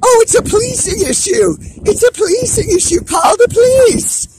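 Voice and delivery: fast, high, sneering parody; high, fast sarcastic parody of the official response; mocking quote. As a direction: mimic the brush-off with scorn. Mocking the official line again.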